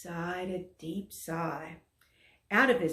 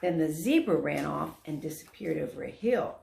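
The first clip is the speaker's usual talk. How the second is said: usual speaking voice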